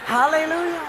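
high, sung-out hallelujah; hammed-up praise. Father after sending kids to eat, hammed-up praise. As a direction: cover the food rush with showy praise. Showy praise covering food rush.

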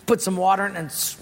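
ordinary talk, nothing marked

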